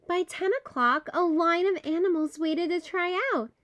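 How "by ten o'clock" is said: ordinary delivery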